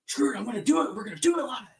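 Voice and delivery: high-pitched angry anchor outburst, imitated; mock screaming. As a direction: yell it like an exasperated anchor losing it. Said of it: "he's just screaming".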